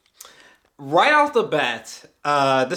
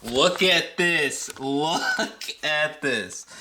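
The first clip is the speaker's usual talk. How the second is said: awed, slow; much slower than usual. Real, not acted. Showing off the merged posters.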